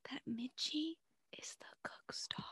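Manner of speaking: secret gossip whisper. Real, not acted. Telling friend the movie twist, hushed whisper. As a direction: breathe it like a secret.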